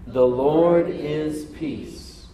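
drawn-out, very slow words; slowed unison proclamation; slow call-and-response. Shown as a demonstration. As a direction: sound it out with the crowd. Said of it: "Can you say that with me?".

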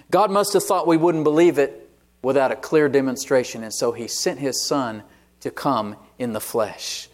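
ordinary delivery

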